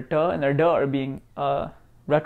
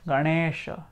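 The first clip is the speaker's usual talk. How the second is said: ordinary talk, nothing marked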